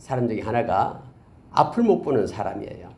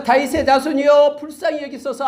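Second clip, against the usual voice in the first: high pleading call, raised voice; crying out; 12 semitones higher, 5 dB louder than usual. Retold or imitated. Blind beggar crying out to Jesus, high pleading call. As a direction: beg out loud over the crowd. 막 계속해서.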